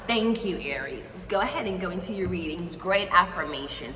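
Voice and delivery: ordinary delivery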